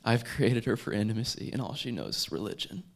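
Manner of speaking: loud, fervent preacher voice, phrase by phrase; impassioned preaching. Acted. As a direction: preach it loudly, stretching key words and pausing between phrases. Preaches God's words at full force.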